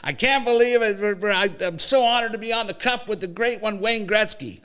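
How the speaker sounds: mocking an overeager athlete speech; mock gushing speech. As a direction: ham up a humble star on the mic. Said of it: "He grabs the mic and he goes".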